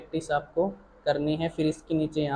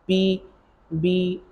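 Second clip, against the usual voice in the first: slow; much slower than usual. Shown as a demonstration. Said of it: "ये बना रहे हैं ना बेटा".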